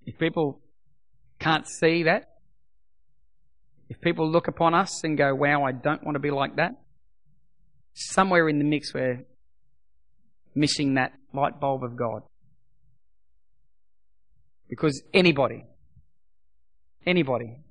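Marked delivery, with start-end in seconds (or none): none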